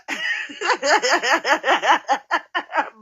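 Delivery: long rippling giggle; real laugh. Real, not acted. Laughing at British America's mama, long real giggle. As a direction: burst into a long, bouncy laugh. Laughs at her own mockery.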